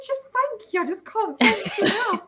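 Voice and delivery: overwhelmed thanks. Retold or imitated. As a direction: squeak out thanks, overcome. Retelling thanking husband emotionally.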